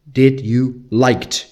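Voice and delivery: Did you liked, said very slowly as a mistake; slow wrong example. Shown as a demonstration. Teacher slowly shows a question form to avoid. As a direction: drag out the wrong form so learners catch it. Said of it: "You don't go".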